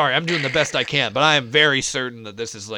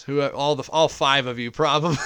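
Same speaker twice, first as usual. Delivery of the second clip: ordinary talk, nothing marked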